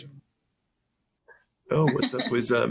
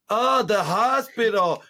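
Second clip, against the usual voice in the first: higher, slow "oh, the hospital" in sudden understanding; imitating an American, slow and higher; mock American; 6 semitones higher, slower than usual. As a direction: drag it out as a clueless American finally getting it. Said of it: "people were like".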